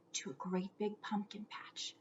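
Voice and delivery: soft whispered aside. A narrator's reading. Narrator whispering to the children where the ghost goes. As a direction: hush it like a secret to listeners.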